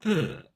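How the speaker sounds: high sharp ugh of disgust; a quick fed-up groan; imitated groan; mock disgusted groan. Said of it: "so I'm like".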